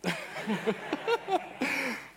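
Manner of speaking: high burst of embarrassed laughter. Real, not acted. Speaker laughing at his own accent attempt on stage. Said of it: "Oh, this is so bad".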